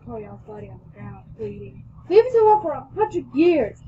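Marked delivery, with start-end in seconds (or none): 2.1-3.8 loud, high, angry outburst; angry yell. A narrator's reading. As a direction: yell it angrily, well above your usual pitch. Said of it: "I yelled angrily".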